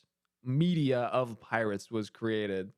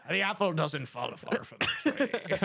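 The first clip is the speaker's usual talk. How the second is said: pirate Newton; 2 semitones higher, slower than usual. Retold or imitated. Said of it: "He was like".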